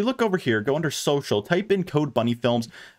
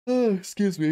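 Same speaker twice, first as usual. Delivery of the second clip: yawning mid-sentence; 6 semitones higher than usual. Real, not acted. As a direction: talk through a yawn.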